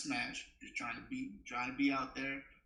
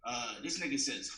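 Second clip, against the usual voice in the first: normal talking voice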